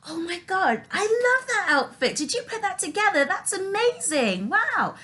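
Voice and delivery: very high, over-the-top gushing; hammed-up fake adoration in a high voice; social butterfly. Mom complimenting a guest's outfit, exaggerated high gushing. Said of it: "be a social butterfly".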